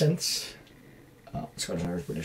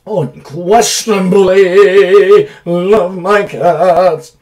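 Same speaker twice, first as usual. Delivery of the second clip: higher, louder, slow drawn-out gush; 8 semitones higher, 17 dB louder, much slower than usual. Real, not acted. About his beloved cats, slow gushing declaration. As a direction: stretch every word with delight.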